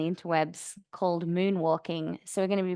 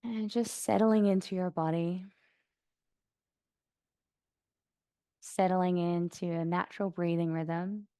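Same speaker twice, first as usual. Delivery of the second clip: soft, even meditation voice with long pauses; guided meditation; 4 dB quieter than usual. Acted. Yoga teacher guiding class to notice sounds around them, calm meditation voice. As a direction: settle them gently into stillness, unhurried.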